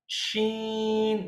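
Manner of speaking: slow, drawn-out "शीन" with a clear sh sound; demo of sheen. Shown as a demonstration. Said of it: "हम शुरू करेंगे आज शीन से". (धीमा, खिंचा हुआ "शीन")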